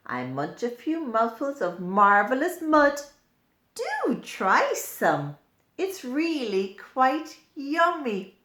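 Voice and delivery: put-on a worm coaxing children to taste mud; worm character. Worm speaks in the book.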